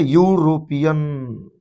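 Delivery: यूरोपियन stretched slowly, opening यू held; drawn-out pronunciation. Shown as a demonstration. Teacher to students, slowly drawing out यूरोपियन's यू sound. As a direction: draw out यूरोपियन so the यू sound is heard. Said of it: "इसको क्या बोलते हैं?".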